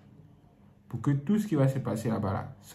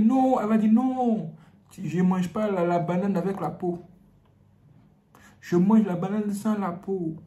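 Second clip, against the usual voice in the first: put-on girl's voice; 7 semitones higher than usual. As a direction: voice the girl playfully. Girl voiced refusing condom.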